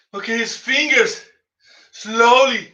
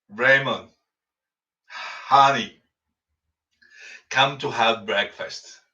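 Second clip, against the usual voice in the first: tender wife; 9 semitones lower, 4 dB quieter than usual. Acted. Wife calls him to breakfast.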